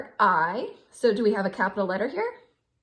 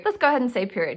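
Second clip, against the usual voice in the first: usual speaking voice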